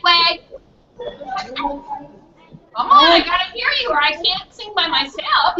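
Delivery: ordinary delivery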